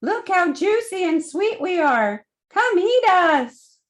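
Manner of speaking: a high sweet voice calling the spider over; calling melon. Acted. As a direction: sing it out like a ripe melon tempting the spider. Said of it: "The ripe melon seemed to call out".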